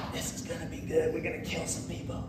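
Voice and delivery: soft, breathy aside of eager soldiers; hushed army gloating. Acted. Soldiers to each other before attacking Jerusalem, hushed. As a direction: mutter it like soldiers relishing a coming raid.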